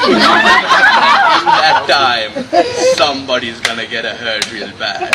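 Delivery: a squeal of laughter that slows into a loud, slow threat; a dad's threat that cracks the teller up; laughing mock threat. Dad to his son about the police delay, laughing through the threat.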